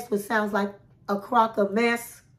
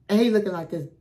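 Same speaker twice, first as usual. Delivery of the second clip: ordinary delivery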